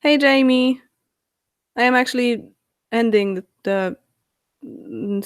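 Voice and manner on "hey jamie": ordinary talk, nothing marked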